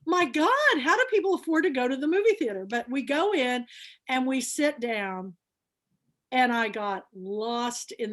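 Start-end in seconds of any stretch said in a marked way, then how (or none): 0.0-2.7 fast, high, louder outburst; marvelling at movie prices, fast and high; astonished. Retold or imitated. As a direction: rush it out in disbelief. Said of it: "I just was like".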